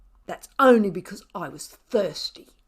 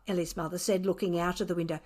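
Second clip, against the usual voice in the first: ordinary delivery, nothing marked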